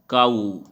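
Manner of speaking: काऊ stretched on its final "oo"; stretched word. Shown as a demonstration. Teacher showing how the word stretches, काऊ drawn out. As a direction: lengthen the ऊ at the end of काऊ. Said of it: "word क्या हो रहा है, stretch हो रहा है".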